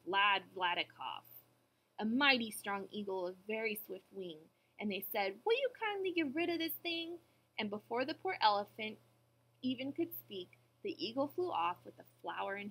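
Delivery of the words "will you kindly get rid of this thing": high-pitched wheedling monkey voice; monkey's request. A narrator's reading. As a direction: ask with put-on politeness, pitched up. Monkeys ask the eagle.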